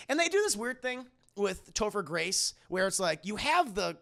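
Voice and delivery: ordinary talk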